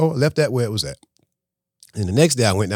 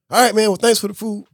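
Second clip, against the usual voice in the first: curt thanks; 12 semitones higher, 4 dB louder than usual. Retold or imitated.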